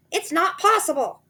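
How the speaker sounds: high anxious wife; high loud anxious denial; Missus Twit voice. A narrator's reading. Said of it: "said Mister Twit".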